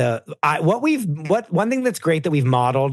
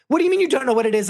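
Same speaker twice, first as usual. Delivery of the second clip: high mock exasperation; mocking exasperated; 10 semitones higher, much faster than usual. Husband mocked in the circular argument, high exasperated squeak. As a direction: throw it back, pitched way up. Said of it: "and then he's like".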